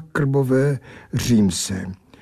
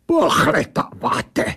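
slow, high affronted echo; indignant accented creature question; blurted out; 5 semitones higher, slower than usual. Acted. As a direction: blurt the word back in creature voice. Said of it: "vybafla ta věc".

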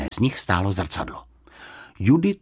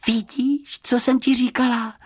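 put-on high-pitched girlish glee; delighted. A narrator's reading. Girl once the housekeeper has gone, delighted. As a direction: rejoice at being proved right. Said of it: "zaradovala se Juditka".

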